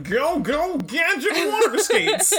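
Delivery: put-on high, laughing old lady voice; very old woman. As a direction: giggle through a high ancient-lady voice. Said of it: "just an ancient old lady being like".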